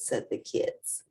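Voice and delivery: ordinary delivery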